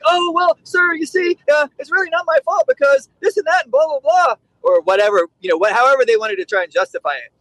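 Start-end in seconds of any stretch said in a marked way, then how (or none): 0.1-4.4 high, mock-whiny excuse making; captain pleading it's not his fault. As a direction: squeak out the excuses like a flustered captain. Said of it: "And they're like".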